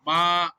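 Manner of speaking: "ma" loud, long and level; sustained "ma" with no pitch change; flat first tone. Shown as a demonstration. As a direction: hold ma steady. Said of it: "if I'm pronouncing ma".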